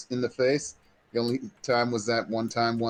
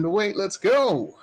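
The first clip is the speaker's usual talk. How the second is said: urgent command; 8 semitones higher, 3 dB louder, slower than usual. Acted. Character urging the group.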